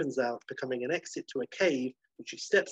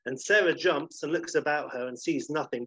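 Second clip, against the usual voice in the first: ordinary talk, nothing marked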